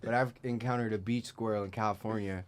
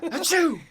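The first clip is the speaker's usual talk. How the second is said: big hammed-up sneeze, high and loud; loud fake sneeze; 6 dB louder than usual. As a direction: belt out a theatrical achoo. Acting out a butterfly sneeze.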